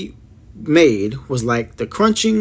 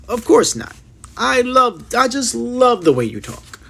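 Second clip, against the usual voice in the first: put-on earnest girl voice; 10 semitones higher than usual. Girl reassures giant warmly.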